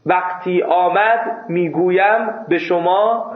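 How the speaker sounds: slow bookish Persian with full verb endings; measured textbook diction; formal written Persian. Shown as a demonstration. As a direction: speak it exactly as written, unhurried. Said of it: "مثل متن نوشتاری".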